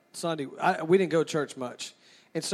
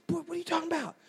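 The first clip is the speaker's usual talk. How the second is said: high-pitched, softer imitation of a mother; exasperated mom; 9 semitones higher, 7 dB quieter, faster than usual. Mother to pestering son in church, high hushed exasperation. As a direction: pitch it way up, baffled and cross.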